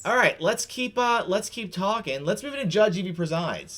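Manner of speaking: loud, high-pitched host announcement; segment announcement. Real, not acted. Host moving the show into Judge Evie Presides, loud announcement.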